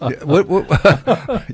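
slow words through laughter; laughing. Real, not acted. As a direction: laugh while stumbling over words.

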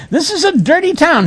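ordinary delivery

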